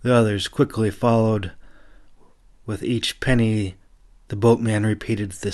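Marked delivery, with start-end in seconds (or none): none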